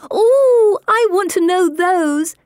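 high, loud eager squeal; excited shout. A narrator's reading. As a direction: squeal with delight. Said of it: "Pippa shouts out".

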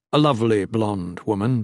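ordinary delivery, nothing marked

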